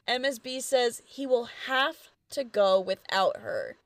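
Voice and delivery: ordinary delivery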